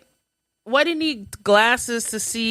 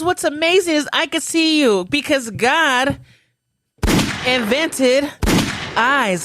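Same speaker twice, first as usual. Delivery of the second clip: loud, higher sarcastic rebuttal; mocking imitation; 5 semitones higher, 7 dB louder than usual. Comic rebutting the ad presenter, loud mocking delivery. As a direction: throw the sales line back loudly, pausing before each punch word.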